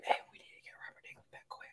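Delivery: fast, secret whisper; mock whisper. Producer to crew, hurried secret whisper. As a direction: whisper it behind his back.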